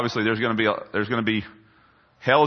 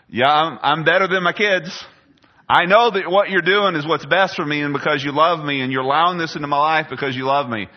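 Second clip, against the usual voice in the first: higher and louder, a believer's fervent declaration; 6 semitones higher, 5 dB louder than usual. Pretend. Pastor voicing trust in God, fervently. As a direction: proclaim it with full conviction. Voices his ideal inner response.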